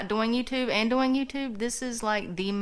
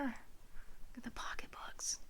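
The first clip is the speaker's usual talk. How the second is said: slow, much softer whisper; 3 semitones higher, 13 dB quieter, slower than usual. Real, not acted. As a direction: draw it out under your breath. Slow whisper in parents' room.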